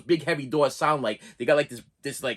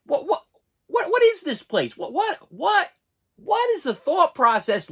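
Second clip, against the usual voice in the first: much higher, squeaky incredulous whats; exasperated disbelief; 12 semitones higher, 3 dB louder than usual. Retold or imitated. Patient to the nurse at dawn, much higher in disbelief. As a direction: throw your voice up in disbelief.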